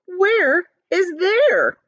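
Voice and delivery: slow, high, baffled question; puzzled asking. Acted. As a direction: demand to know where. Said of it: "they asked".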